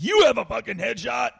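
high loud jeering yell; mock shout. Comic quoting himself shouting at the heckler, loud jeer. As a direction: fling it back up high.

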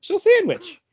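high, silly squeak on the name; jokey silly voice. Acted. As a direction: say the name like a joke.

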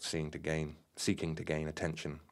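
ordinary delivery

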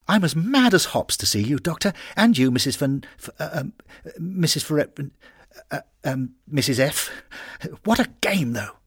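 high, excited lad stumbling over names; a flustered skipper tripping over "Missus Ferret"; eager young footballer. Acted. Skipper greeting the doctor and his wife, high and eager.

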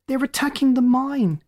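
high, loud alarmed shout; urgent shout. A narrator's reading. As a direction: yell the alarm, stress attacking. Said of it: "the lookout's voice taunted".

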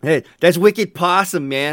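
loud high Boston "Hey, that's Wicked Possum, man"; hammed-up Bostonian with "wicked" punched; Boston guy hyping the beer, loud and high; mock Boston accent. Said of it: "Bostonian to make this sound better".

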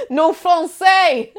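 drawn-out broken French, high and loud; slow, loud tourist French; slow mock French. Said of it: "Like this".

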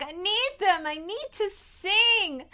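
high, pleading, drawn-out last word; desperate breakdown. Retold or imitated. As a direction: act out a desperate meltdown. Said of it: "this is the breakdown moment".

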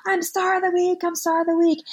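high-pitched imitation of a jubilant little boy; happy cheering. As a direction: mimic a five-year-old proudly cheering his news. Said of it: "he was cheering. He was so happy".

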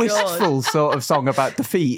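giggling through the words; laughing. Real, not acted. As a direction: laugh while describing it. Joking about sad ballad, giggling.

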